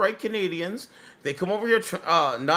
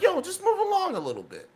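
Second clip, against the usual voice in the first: casual cop; 10 semitones higher than usual. Retold or imitated. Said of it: "So he's just like".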